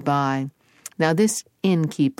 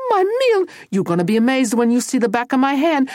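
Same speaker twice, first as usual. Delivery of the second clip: high, loud scolding mother; shrieky, fast maternal threat; angry Mama; 8 semitones higher, 5 dB louder than usual. Acted. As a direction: threaten him like a fed-up mama. Furious Mama threatens Tony.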